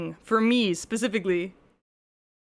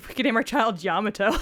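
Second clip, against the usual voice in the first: ordinary talk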